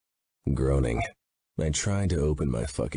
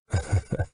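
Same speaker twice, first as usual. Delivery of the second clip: short grim chuckle; a bitter little laugh; dark laugh; 4 semitones higher than usual. A narrator's reading. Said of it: "with a dark laugh".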